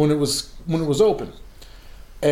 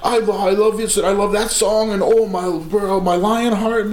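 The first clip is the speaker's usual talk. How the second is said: imitated fan babble, higher pitched; stream-of-consciousness gushing; 5 semitones higher than usual. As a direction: gush breathlessly at your idol in mock adoration. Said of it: "stream of consciousness".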